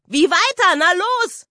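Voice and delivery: high, loud, cheeky challenge; goading. A narrator's reading. Lisa dares her stuck brother to keep rhyming: high, cheeky goading. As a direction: dare him to go on.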